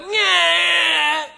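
high, loud whiny "nyah" cry; mock baby wail. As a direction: wail like a frustrated baby giving up. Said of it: "And then they sit down".

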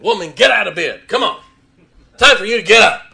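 loud, high, bossy shout at his wife; gruff barking wake-up. Pretend. Husband yelling his wife awake. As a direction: bark her out of bed. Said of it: "Do you think I got up at four thirty, shook my wife and said".

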